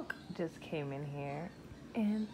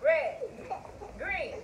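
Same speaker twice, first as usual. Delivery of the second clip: loud, high called commands; game calling; 9 semitones higher, 3 dB louder, slower than usual. Real, not acted. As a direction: call each color across the yard.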